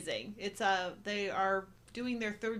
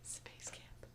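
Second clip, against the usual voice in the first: hushed whisper; awed whisper; 21 dB quieter than usual. Pretend. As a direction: whisper it like a magic word. Awed aside about space camp.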